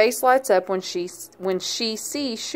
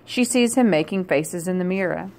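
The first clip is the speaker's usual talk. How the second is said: ordinary delivery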